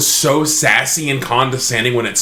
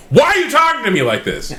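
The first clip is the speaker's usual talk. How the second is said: indignant retort; 8 semitones higher than usual. Retold or imitated. Retells angry outburst at the device.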